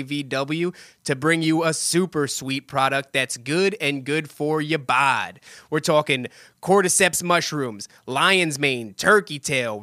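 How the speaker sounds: upbeat sponsor read, slow on the promo code. Acted. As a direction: sell it like a podcast ad.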